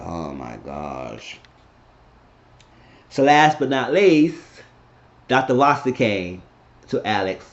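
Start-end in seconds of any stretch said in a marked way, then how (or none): 0.0-1.4 low, soft, very slow; stunned dismay. Retold or imitated. Onlooker watching her get handcuffed, stunned. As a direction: murmur it slowly, deep and stunned. Retold stunned reaction to arrest.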